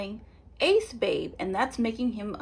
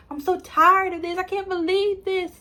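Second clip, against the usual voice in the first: exasperated outburst; 8 semitones higher, 5 dB louder than usual. Retold or imitated. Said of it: "Austin starts low-key crashing out".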